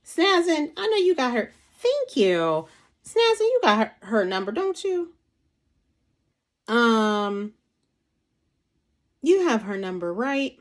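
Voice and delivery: high-pitched call to a viewer in the chat. Real, not acted. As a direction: sing out her name to get her attention. Calling out to a viewer.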